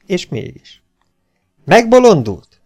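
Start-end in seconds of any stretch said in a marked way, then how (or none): 1.6-2.6 high, loud startled shout. A narrator's reading. Hufeisen yells at the baron in disbelief, loud shout. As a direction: yell it at the comrade in disbelief. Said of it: "Kiáltotta Hufeisen".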